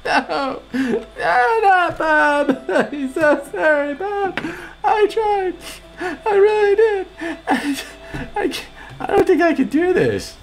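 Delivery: high, strained laughing sobs; half laughing, half crying over Bob. Real, not acted. Laugh-crying over Bob's death.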